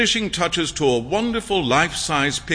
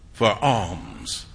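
ordinary delivery

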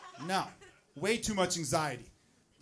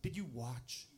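hushed, slow, softer prying question; curious people asking; 5 semitones lower, 9 dB quieter than usual. Retold or imitated. People asking Joe about the birth, hushed curious question. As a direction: lean in and ask it quietly, like a nosy friend. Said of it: "they go".

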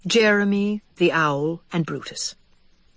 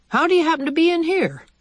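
much higher and quick, startled; startled old mouse; 11 semitones higher, faster than usual. Acted. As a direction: caught by surprise, words tumbling out. Aegis startled to find her.

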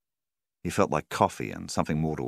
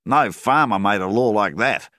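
louder, higher angry yell; 6 semitones higher, 10 dB louder than usual. A narrator's reading. Farmer yelling at the banker over the law, loud. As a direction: shout it at the banker in outrage. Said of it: "Milvan yelled".

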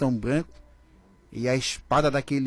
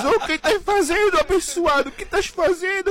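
presos assustados; 13 semitones higher, 4 dB louder than usual. Retold or imitated.

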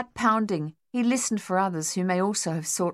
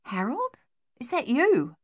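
startled question; 7 semitones higher than usual. Acted. Startled Dave calls in dark.

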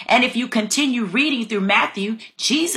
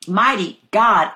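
normal talking voice

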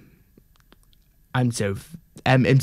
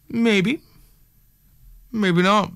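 teasing; 9 semitones higher, slower than usual. Pretend. Teasing chat about singing.

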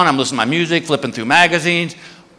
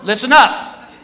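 raised, higher announcing voice; addressing a whole line; 4 semitones higher than usual. Retold or imitated. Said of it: "he goes to this whole security line".

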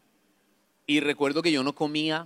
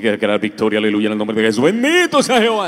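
mock church declaration; 4 semitones lower, 10 dB louder, faster than usual. Imitating churchgoers who must declare victory, loud and building. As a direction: proclaim it like an over-zealous believer. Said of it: "así la gente".

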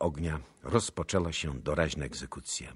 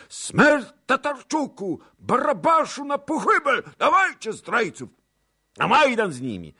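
high, full-force bloodthirsty yelling; drunken mob shouting; 10 dB louder than usual. A narrator's reading. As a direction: yell like a drunk lynch mob. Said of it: "krzyczały pijane głosy". (pijackie wrzaski rozjuszonej tłuszczy)

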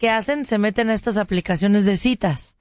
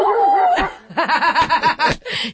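a burst of real laughter; 5 semitones higher than usual. Real, not acted. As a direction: laugh out at your own story. Laughs at own storytelling.

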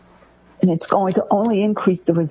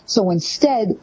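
ordinary delivery

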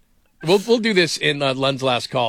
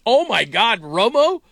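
higher, drawn-out disbelief; exaggerated "can you believe it" amazement; mock astonishment; 7 semitones higher than usual. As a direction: react as if stunned by the figure. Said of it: "we all just went".

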